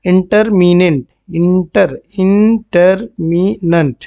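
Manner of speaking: "इंटरमिनेंट" slowly stretched, part by part; syllable-by-syllable pronunciation. Shown as a demonstration. Teacher modelling the word for students, "इंटरमिनेंट" drawn out by syllable. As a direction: sound out "इंटरमिनेंट" slowly as in-ter-mi-nant. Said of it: "क्या बोलते हैं".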